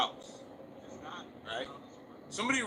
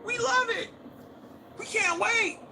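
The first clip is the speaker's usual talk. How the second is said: much higher, gushing fan voice; high squealing imitation of hyped chat; mock excited fans; 10 semitones higher than usual. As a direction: ham up the thrilled viewers.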